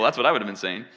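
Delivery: ordinary talk, nothing marked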